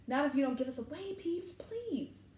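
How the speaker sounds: high, quick plea rising on the last word; pleading. A narrator's reading. Students begging Peeves not to tell, pleading. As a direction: beg urgently in a high voice.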